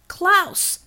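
high, sharp call of a name; urgent shout across the plaza. Acted. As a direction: cut through the noise with his name. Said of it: "Shakti's voice cut through his reverie".

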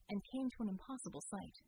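ordinary delivery

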